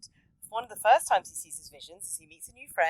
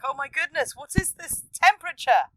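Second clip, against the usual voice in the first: mock heat shock; 7 semitones higher, 6 dB louder than usual. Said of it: "It's like".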